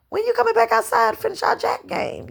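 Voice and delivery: put-on a kid asking her friend to come back out; child calling at door. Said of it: "and I'd be like".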